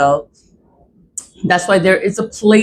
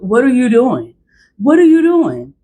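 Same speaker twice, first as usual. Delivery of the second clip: incredulous, rising higher on the repeat; exasperated demand; 6 semitones higher, 3 dB louder than usual. Real, not acted. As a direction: demand it in disbelief, pitch climbing. Exasperated rant at absent court staff.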